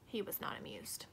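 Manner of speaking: usual speaking voice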